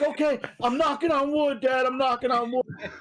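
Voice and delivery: put-on sheepish kid answering his dad; kid's voice. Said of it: "he'll be like".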